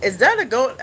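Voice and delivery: ordinary talk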